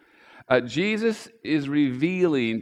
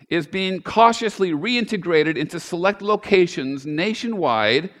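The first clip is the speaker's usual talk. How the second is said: raised, projected reading of a mock news report; firm, louder announcing delivery, higher than chat; news article read aloud; 4 semitones higher, 6 dB louder than usual. Real, not acted. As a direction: read it out like a straight news story. Reads satirical news article aloud.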